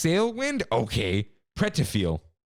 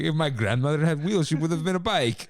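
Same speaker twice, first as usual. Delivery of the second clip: put-on a light Italian accent, quoting the chef; lightly imitated Italian accent, amused; 5 semitones higher than usual. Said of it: "And then his Italian accent".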